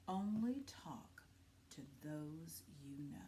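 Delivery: slow hushed whisper. Acted. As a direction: recall the rule in a secret whisper. Remembered rule whispered.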